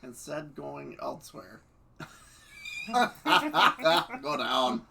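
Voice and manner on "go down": loud laugh through the words. Real, not acted. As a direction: crack up as you say it. Reader laughing at the text.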